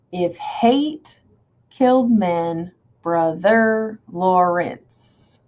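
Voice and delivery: very slow "If hate... killed men... Brother Lawrence", pausing between feet; exaggerated scansion. Shown as a demonstration. Teacher deciding where stress falls, very slow separated beats. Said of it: "where's the stress and unstressed?".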